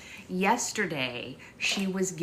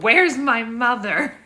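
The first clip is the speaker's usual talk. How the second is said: mock baby demand; 6 semitones higher, 10 dB louder, slower than usual. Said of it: "He was like".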